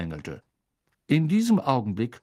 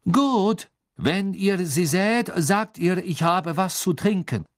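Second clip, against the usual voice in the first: high, loud, drawn-out words; sullen foreign champion; 8 semitones higher, 4 dB louder, slower than usual. Acted. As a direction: spell out the message, disgruntled. Sullen Krum leaves a message.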